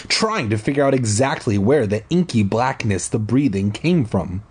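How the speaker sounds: usual speaking voice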